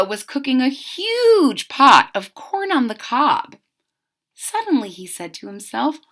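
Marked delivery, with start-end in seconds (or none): none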